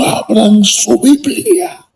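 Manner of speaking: high slow pulpit imitation; imitating the old preacher. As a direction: stretch each word like the fiery village preacher. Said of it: "así hablaba el hombre".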